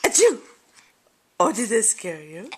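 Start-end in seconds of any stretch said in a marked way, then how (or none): 0.0-0.4 loud, playful put-on sneeze; a big hammed-up achoo for the baby; fake sneeze. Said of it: "Mommy's gonna sneeze".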